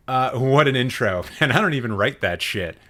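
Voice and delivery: ordinary delivery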